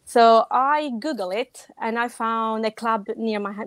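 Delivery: normal talking voice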